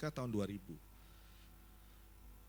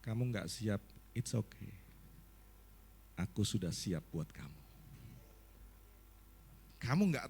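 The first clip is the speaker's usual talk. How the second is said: voice of God; 6 semitones lower than usual. Retold or imitated. God telling the unprepared pastor it is okay, low and soothing. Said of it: "Tuhan itu ngomong kayak gini".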